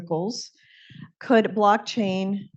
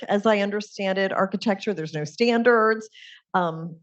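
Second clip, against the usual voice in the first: usual speaking voice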